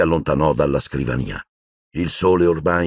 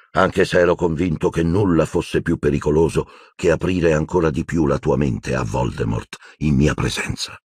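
usual speaking voice

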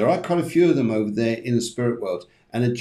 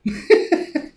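high, voiceless burst of laughing; out-of-breath laugh; breathy laughter; 3 dB louder than usual. Real, not acted. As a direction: wheeze with real laughter. Still laughing at the mishap.

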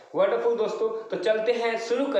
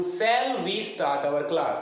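drawn-out question to the class; slow teacher question; much slower than usual. Shown as a demonstration. Teacher asks the class a question, very slowly. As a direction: ask it slowly so they follow. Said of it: "मैं आपसे question पूछ लेता हूँ".

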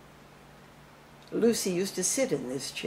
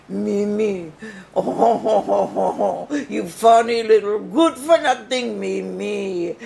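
put-on French accent; 3 semitones higher, 5 dB louder than usual. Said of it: "singing [name] with the French accent".

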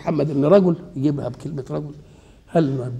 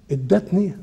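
ordinary talk

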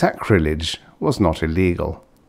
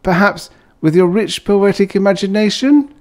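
mocking; 13 semitones higher, 5 dB louder than usual. Uncle's mocking question.

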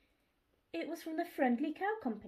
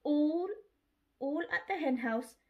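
ordinary delivery

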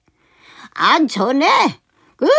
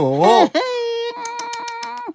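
high mocking laugh; 8 semitones higher than usual. Master laughs at servant.

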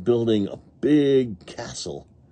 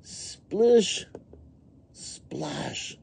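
high splish, whispered splash; paddle splashing; 8 semitones higher than usual. A narrator's reading. Person in the canoe boat, high then whispered splashes. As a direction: imitate water splashing, high then hushed. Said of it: "says the person in the canoe boat".

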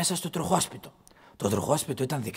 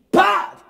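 loud, high exploding slap sound; imitated slap noise; 10 dB louder than usual. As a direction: fire off a slap effect at full voice. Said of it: "Χαστούκ". (δυνατός ήχος χαστουκιού)

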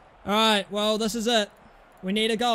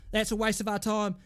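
ordinary talk, nothing marked

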